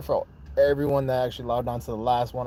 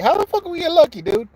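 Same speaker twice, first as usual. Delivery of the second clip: much higher and louder, incredulous; indignant outburst; 7 dB louder than usual. Real, not acted. Vlogger rejecting a viewer's claim the Raiders got lucky, loud indignant outburst. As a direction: fire it back at the doubter.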